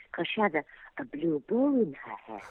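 put-on very girlish, softly spoken little character; girlish Posy. Shown as a demonstration. Voice actress shows children's puppet Posy, girlish and soft. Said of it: "so we made her talk like that".

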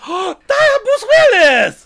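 gasping with excitement, high and loud; starstruck gasp. Pretend. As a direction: recognize a movie star, thrilled. Gasping fan recognizing celebrity.

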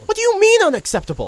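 can't believe what he just said; shocked, pitch leaping up; incredulous outburst. Acted. Arguing with stubborn toad.

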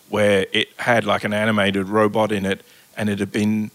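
ordinary talk

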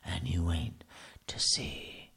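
low, hushed spooky storyteller voice with a breathy edge. Acted. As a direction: tease them like a ghost story. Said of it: "I don't know why I even spoke like that".

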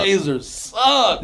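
slow, drawn-out dismissive jab; slow teasing jab. Real, not acted. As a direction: stretch it out to needle him. Teasing friend who loves Blazers.

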